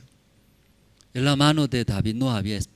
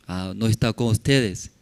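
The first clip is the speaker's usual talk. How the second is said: ordinary delivery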